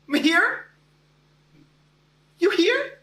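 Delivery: high, searching teacher voice; puzzled teacher. Retold or imitated. Teacher searching the classroom for the student, puzzled. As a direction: call out the odd name as a question. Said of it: "she paused and said".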